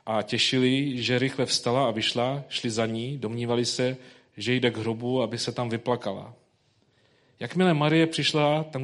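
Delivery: solemn public reading of scripture. Acted. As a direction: read the Gospel passage aloud to the congregation. Reads the Gospel passage.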